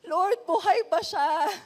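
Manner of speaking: frightened plea. Retold or imitated. Frightened prayer after crash.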